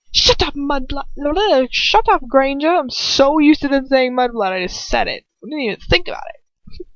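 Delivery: loud, bitter snarl; venomous anger. A narrator's reading. Draco snapping at Granger, bitterly angry and loud. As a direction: spit it out in bitter fury. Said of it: "Draco mutters venomously".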